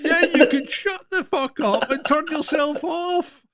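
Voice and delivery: high, slow mock fury through laughter; retold exasperated rant. Owner snapping back at helpful phone, retold exasperated rant. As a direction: laughingly imitate losing your temper, pitched right up.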